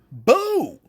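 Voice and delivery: high, loud jeering boo; mock boo. Fans booing a preachy heel, high loud boo. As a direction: boo them off the stage.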